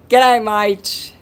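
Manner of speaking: broad, loud, high Aussie G'day mate; exaggerated Australian greeting; Australian accent. Repeats greeting in stronger accent.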